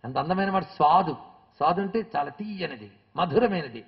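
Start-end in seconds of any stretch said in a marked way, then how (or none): none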